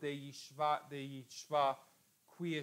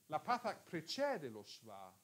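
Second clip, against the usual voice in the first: ordinary delivery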